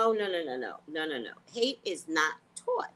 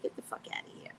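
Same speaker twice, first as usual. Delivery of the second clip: hushed disgusted whisper; muttered aside; 12 dB quieter, faster than usual. Real, not acted. Scrolling a bad feed, a disgusted whisper. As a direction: whisper it under your breath in disgust.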